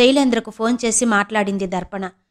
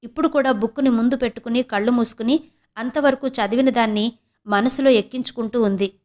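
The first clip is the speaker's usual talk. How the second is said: usual speaking voice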